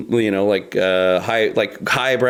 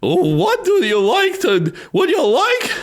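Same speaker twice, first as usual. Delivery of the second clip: Mad Hatter voice. Acted. Trying his Mad Hatter voice for the podcast, high and squeaky. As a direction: squeak it out like a mad tea party host. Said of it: "Mad Hatter voice".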